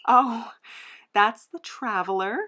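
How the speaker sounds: ordinary delivery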